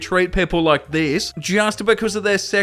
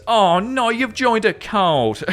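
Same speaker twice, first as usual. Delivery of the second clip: higher and slow, hammed-up dismay; drawn-out mock horror; mock alarm; 5 semitones higher, slower than usual. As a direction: play up fake shock at the cult news. Host mock alarm at message.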